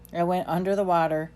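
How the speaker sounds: usual speaking voice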